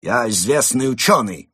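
loud, affronted protest; indignant. A narrator's reading. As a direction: declare it with offended dignity. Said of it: "возмутился Крот".

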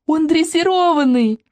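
put-on slow, high girl's discovery; child realizing with glee; excited little girl. Girl guesses, delighted.

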